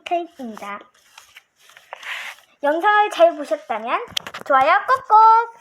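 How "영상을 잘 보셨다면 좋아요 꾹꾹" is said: loud, eager outro call; excited shout. Real, not acted. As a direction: shout it out to the viewers. Outro asking viewers to like.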